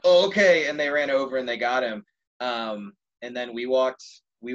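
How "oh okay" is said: startled okay. Retold or imitated. Friends startled into agreeing.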